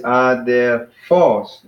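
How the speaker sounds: "adelphos" slowly, syllable by syllable, landing on PHOS; last-syllable stress. Shown as a demonstration. Teacher demonstrating the accent on the last one, end-stressed. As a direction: draw out "adelphos" with the weight on the end. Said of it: "accent is on the last one".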